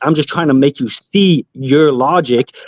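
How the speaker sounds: ordinary talk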